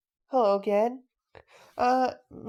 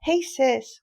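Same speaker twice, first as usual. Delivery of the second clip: ordinary talk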